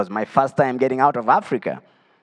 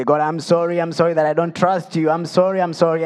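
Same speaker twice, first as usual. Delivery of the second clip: higher, urgent run of apology; retold repenting; 5 semitones higher than usual. Repenting to God for not trusting, retold as urgent pleading. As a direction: plead it in rising, repeated bursts. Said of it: "I started repenting and say".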